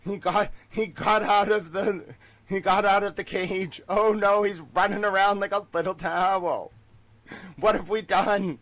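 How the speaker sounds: high, loud put-on wail of alarm; comic shouted dismay; mock panic. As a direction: cry out as a horrified captor, hammed up. Acts out captors after his escape.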